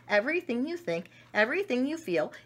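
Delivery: ordinary delivery, nothing marked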